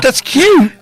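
high, cooing mock imitation; exaggerated squeaky echo of a co-host; mocking mimicry. Mimics co-host's "that's cute.

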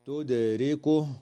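تدركه with the dal bounced lightly; dal echo. Shown as a demonstration. Teacher showing the dal echo in تدركه. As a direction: give the dal a small echo. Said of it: "تقلقل الدال في كلمة".

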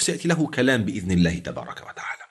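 ordinary talk

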